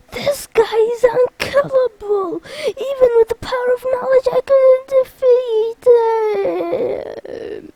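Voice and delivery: high, hammed-up lament; despairing wail. Zexion lamenting his defeat, high wail. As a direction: wail it in mock despair, stretching the end.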